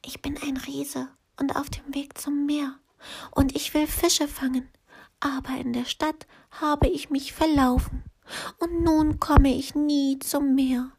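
a lost giant close to tears; sad whispering giant. A narrator's reading. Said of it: "flüsterte der Riese".

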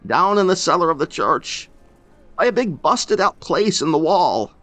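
put-on loud, high tearful old man; weeping. Grieving old man tells the stranger how they were found, weeping. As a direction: sob the words out, voice breaking. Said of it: "weeping openly".

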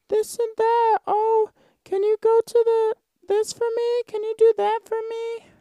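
very high sugary mock girl voice; cutesy girlfriend voice. Vlogger mocking a new girlfriend, very high cutesy coo. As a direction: coo every line like a doting girlfriend. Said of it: "They're all like".

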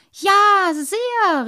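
put-on high, loud, overjoyed child's cry; a little boy bursting with delight; thrilled. A narrator's reading. Little water-boy riding the carp cries out, thrilled. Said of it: "rief der kleine Wassermann hellauf begeistert".

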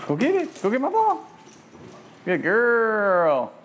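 gushing praise for the dog; excited dog praise. Real, not acted. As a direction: cheer the dog on.

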